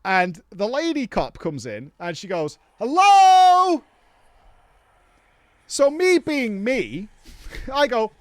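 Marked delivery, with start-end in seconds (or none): 2.8-3.8 high, loud, drawn-out hello; imitating a cop hollering hello; calling out. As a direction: call into an empty room, high and loud. Cop calls into empty entrance.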